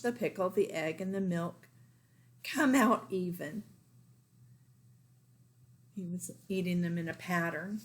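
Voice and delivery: ordinary delivery, nothing marked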